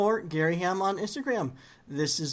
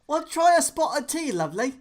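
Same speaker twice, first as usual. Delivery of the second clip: high, loud tea-time British caricature; jokey British voice, starting high and big; mock British accent; 8 semitones higher, 5 dB louder than usual. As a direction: ham up a cliché British accent. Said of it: "do the Brit accent".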